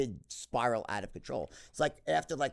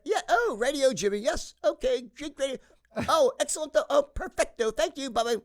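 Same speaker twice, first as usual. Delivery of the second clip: much higher, loud hammed-up British aristocrat; posh British phone chat; 13 semitones higher, 6 dB louder than usual. Dad chatting on the phone in hammed-up British. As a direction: play the gracious English gentleman on the phone. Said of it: "he'd get this Brit".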